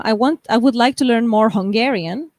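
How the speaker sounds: usual speaking voice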